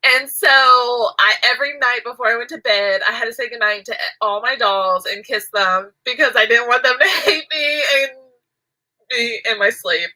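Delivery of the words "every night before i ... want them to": ordinary talk, nothing marked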